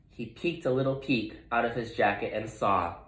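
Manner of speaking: plain reading voice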